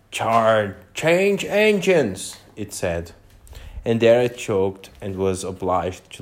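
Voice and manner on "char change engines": lightly voiced hoarse horse, slow and choking. A narrator's reading. Horse in the carriage choking out a word, a hint of hoarseness. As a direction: choke the words out slowly like a hoarse horse. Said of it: "a hoarse voice spoke next".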